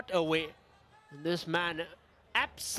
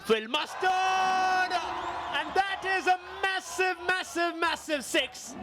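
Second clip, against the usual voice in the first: excited cricket commentary; 6 semitones higher, slower than usual. Real, not acted. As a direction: shout the six with delight. Commentator calls a huge six.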